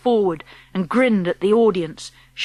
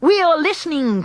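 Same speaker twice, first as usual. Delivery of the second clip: eager cry; 7 semitones higher than usual. Acted. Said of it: "cried the audience".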